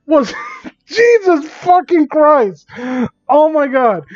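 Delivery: real shocked laughter; shocked laughing outburst. Real, not acted. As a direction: shriek in disbelief through laughter. Shocked reaction to fanfic.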